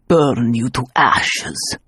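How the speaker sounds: a dark voice from the past; menacing memory voice. Acted. Haunting voice from the past condemning her, menacing.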